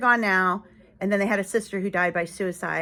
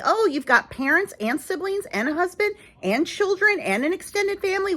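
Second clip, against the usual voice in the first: higher mocking sing-song; mocking list of what others have; mock bitter; 10 semitones higher than usual. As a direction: sneer at their luck, pitched up. Mocking people with families.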